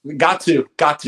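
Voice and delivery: loud insistent agreement; emphatic. Real, not acted.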